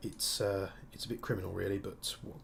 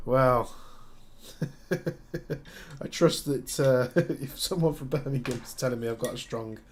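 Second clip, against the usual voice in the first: laughing; 4 semitones higher, 8 dB louder than usual. Real, not acted. Laughs at viewer's accent verdict.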